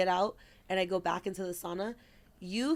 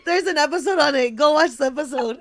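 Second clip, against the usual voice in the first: loud and higher, laughing through the words; 8 semitones higher, 13 dB louder than usual. Real, not acted. Laughing hard, telling listeners to watch the episode. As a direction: laugh while you urge them to watch.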